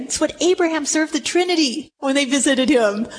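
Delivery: ordinary talk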